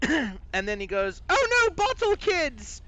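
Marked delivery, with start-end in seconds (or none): 1.3-2.8 very high, loud British cry of alarm; British accent. Acted. Skull spots bottle-throwing red caps, shrill British accent. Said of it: "a British accent".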